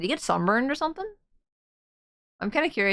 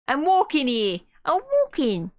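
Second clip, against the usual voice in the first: put-on indignant New York pedestrian, Brooklyn accent; 5 semitones higher than usual. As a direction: protest in rising Brooklyn wiseguy voice. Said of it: "Oh, Brooklyn like Meowth".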